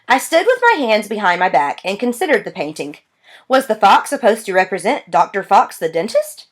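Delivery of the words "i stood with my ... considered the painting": plain reading voice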